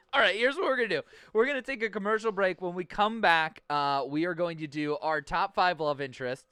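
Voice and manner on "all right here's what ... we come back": announcer voice. Acted. Host announcing commercial break.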